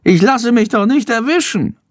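high squeaky bragging voice; boastful bat voice. A narrator's reading. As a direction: brag in a tiny high voice. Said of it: "prahlt Chandor".

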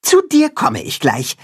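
high, earnest rallying voice; high earnest cat-hen rallying the farm animals. Acted. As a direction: unite them, high and determined.

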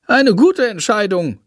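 put-on a small bat praising the boy for his choice. A narrator's reading. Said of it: "lobt er Jendrik".

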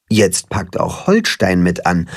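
usual speaking voice

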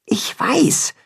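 breathy whispered reply. A narrator's reading. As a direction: whisper so no one hears. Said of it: "flüstert Timme".

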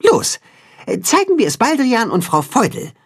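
rally the friends with an order; instructing. A narrator's reading. The cat-chicken directs the friends to the pond, instructing. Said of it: "weist das Katzenhuhn die Freunde an".

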